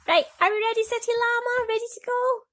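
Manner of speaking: teacher hyping up City Llama, excited; excited call. Acted. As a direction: rev up the puppet for the story. Asks the toy llama if ready.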